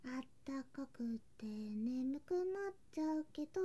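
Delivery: soft, slow, sleepy bath talk; melting into the warm water, half asleep; drowsy murmur. Acted. (お風呂でうとうと、ゆっくり小声で)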